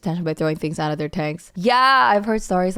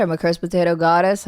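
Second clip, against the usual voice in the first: normal talking voice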